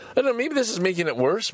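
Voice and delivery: high, fast nervous rush; anxious. Real, not acted. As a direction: blurt it out, nerves rising. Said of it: "I am getting really psyched up".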